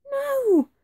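high-pitched witch's cry; frightened cry. A narrator's reading. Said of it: "cried the witch".

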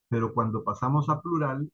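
normal talking voice

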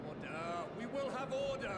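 stern chairman's call for order. Acted. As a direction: call the hall to order over the chatter. Gavel call to order in assembly.

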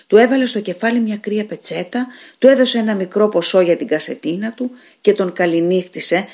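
plain reading voice

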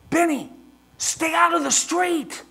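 loud, high, slow stern command; imitating dad. Son imitating father telling Benny to stay off the street, loud high. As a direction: mimic dad laying down the law. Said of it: "He's sitting here going".

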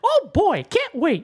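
high squeaky mouse voice; Mickey voice. Pretend. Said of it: "Stop with the voices".

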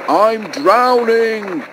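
high, slow, drawn-out cry; drowning plea. A narrator's reading. Sinking Bulstrode cries out slowly, high and desperate. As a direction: wail it out as you sink. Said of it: "he spluttered".

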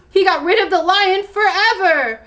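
high-pitched celebration; jubilant. A narrator's reading. Monkey praising chameleon for beating lion, jubilant. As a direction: keep cheering, pitch up.

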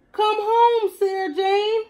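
very high, loud dog howl; howling cry. A narrator's reading. Lonely dog howling for the cat at the door. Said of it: "he cried".

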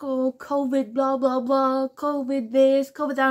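mock fussing, drawn-out words; mocking imitation. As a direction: parody a nagging landlady's blah-blah. Mocking the landlady's covid fussing.

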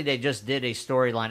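ordinary delivery

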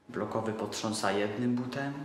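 ordinary delivery

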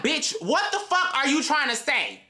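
snapping back at him in disbelief; high outraged yell. Retold or imitated. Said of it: "And I'm like".

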